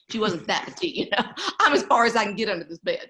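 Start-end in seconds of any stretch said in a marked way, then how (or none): 1.4-3.1 laughing. Retold or imitated. Retold again laughing.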